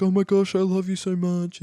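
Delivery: hammed-up tearful love declaration; mock gushing. Cam on the sat phone gushing to his sister, mock emotional. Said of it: "Cam's like".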